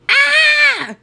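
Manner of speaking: high, loud "aah" scream; piercing yelp of a horrified boy; horrified yell. Acted. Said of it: "yelled Andrew".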